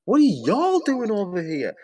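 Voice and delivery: very high, drawn-out mock question; exaggerated mocking mimicry. Repeating her question to ridicule her, high and drawn-out. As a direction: stretch her challenge way up high, swooping.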